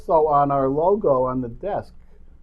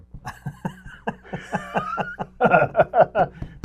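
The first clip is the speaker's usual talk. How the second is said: a long, rising real laugh at his own joke; 9 semitones higher than usual. Real, not acted.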